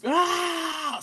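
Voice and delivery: high, drawn-out mock scream; retold scream, high and held; imitated heartfelt scream. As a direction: imitate an anguished cry.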